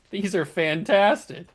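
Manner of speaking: ordinary talk, nothing marked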